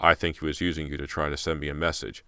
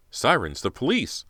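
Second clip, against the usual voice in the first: excited; 8 semitones higher, 3 dB louder than usual. A narrator's reading. Rescue arriving.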